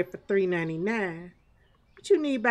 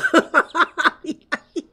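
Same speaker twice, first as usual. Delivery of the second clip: loud high laugh; 7 semitones higher, 7 dB louder than usual. Real, not acted. Streamer laughing at customers in the store. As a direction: crack up.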